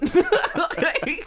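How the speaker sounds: high, loud burst of laughter. Real, not acted. Laughing hard at the old woman story. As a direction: crack up loudly.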